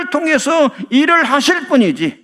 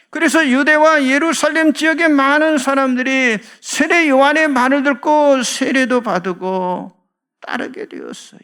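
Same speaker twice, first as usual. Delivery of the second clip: normal talking voice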